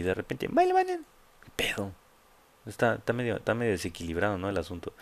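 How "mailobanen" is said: sudden very high squeak; high squeaky mimicry. Streamer showing the alert voice's sudden flip, high squeaky mimicry. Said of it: "de repente tiene una pinche voz".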